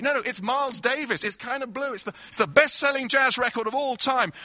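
high, loud, carried-away enthusiasm; gushing host. Acted. Host lecturing his vegetarian guest on jazz, gushing loudly. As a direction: rave about the record, not listening.

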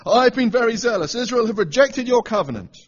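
loud, high, protesting voice; Elijah complaining. Retold or imitated.

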